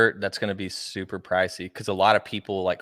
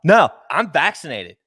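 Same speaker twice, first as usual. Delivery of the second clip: high, loud, pushy confrontation; confrontational filming guy; 9 semitones higher, 5 dB louder than usual. Pretend. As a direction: demand it like a self-righteous filmer. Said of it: "I'm gonna be like".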